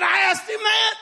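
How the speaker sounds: very high, strained re-enacted protest; exasperated cry. Husband answering wife's when, exasperated. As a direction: cry it out at the top of your range.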